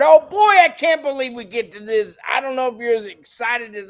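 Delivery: high, loud burst of excitement; excited shout. Real, not acted. Shouting with joy to welcome Tallahassee viewers. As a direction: whoop with delight at the new viewers.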